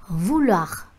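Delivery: "Vouloir" with the final R clearly sounded. Shown as a demonstration. Teacher showing students the French ending, final R sounded. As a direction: say "Vouloir" and let the last R be heard. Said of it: "thì các bạn sẽ phải đọc là R".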